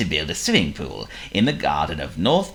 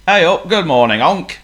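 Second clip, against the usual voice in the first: high, loud cheery northern shopkeeper; 12 semitones higher, 8 dB louder than usual. Acted. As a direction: boom it out like a jolly shopkeeper. Shopkeeper character voice.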